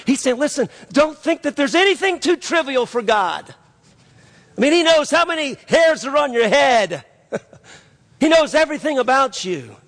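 high, impassioned sermon delivery; fervent preaching. Acted. Preaches with rising fervour.

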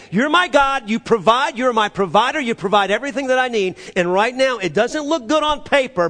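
high, forceful declaration of faith; preach. Real, not acted. Preacher declaring trust in God before the church, forceful preaching. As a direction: raise the voice and proclaim it.

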